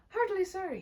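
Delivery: a hint of the nephew's voice; quoting the nephew. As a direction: echo the gentleman's kind words.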